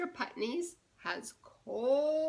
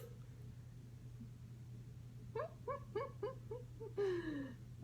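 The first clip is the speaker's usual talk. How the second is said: breathy high laugh; 9 semitones higher, 10 dB quieter than usual. Real, not acted. Laughing at own joke.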